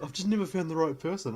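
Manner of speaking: whiny contestant. Retold or imitated. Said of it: "they're like".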